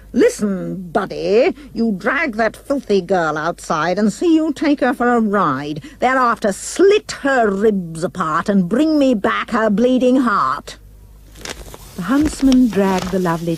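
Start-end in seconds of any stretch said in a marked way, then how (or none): 0.1-10.8 bossy queen giving orders; a hint of a bossy queen; shouting queen. A narrator's reading. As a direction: order the huntsman about. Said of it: "She shouted at him".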